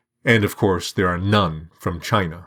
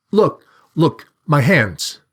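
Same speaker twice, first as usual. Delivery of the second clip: higher-pitched, urgent appeal; pleading; 7 semitones higher, 4 dB louder, slower than usual. A narrator's reading. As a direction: beg him to check the proof, mildly anxious. Accused student offers proof.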